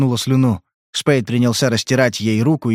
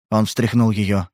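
ordinary delivery

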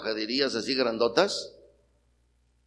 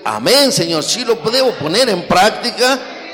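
loud, high, slow declaration of faith; fervent amen; 6 semitones higher, 7 dB louder, slower than usual. Shown as a demonstration. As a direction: proclaim it like the whole church should answer. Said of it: "usted debe decir".

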